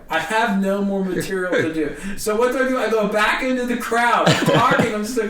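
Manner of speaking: ordinary talk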